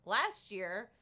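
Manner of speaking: normal talking voice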